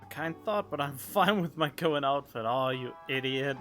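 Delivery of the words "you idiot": usual speaking voice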